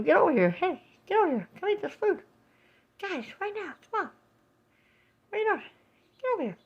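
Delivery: high mock bird calling its friends; squeaky little bird bossing the others. Mom voicing a bird calling others to the feeder, high squeaky. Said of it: "He's telling them".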